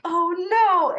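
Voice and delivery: sudden high alarmed cry. Retold or imitated. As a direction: blurt it out in alarm. Dogs loose, lambs break fence.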